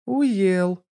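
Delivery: short amused laugh word; chuckling. A narrator's reading. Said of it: "хохотнул смартфон".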